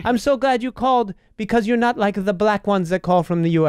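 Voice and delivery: put-on Filipino accent. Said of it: "an impression of a Filipino lady".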